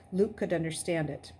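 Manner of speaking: ordinary delivery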